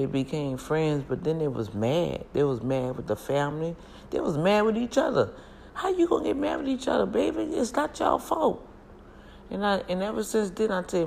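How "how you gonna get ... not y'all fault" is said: high, quick exasperated scold; sky-high sassy reproach; incredulous scolding. Real, not acted. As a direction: tell them off in disbelief. Scolding the feuding cousins.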